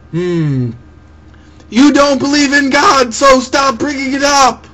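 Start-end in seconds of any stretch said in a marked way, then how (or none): 1.7-4.7 high, loud whiny heckler voice; mock critic. Voicing the commenter who says stop bringing it up, mock whiny. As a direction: play the nagging critic, pitched up.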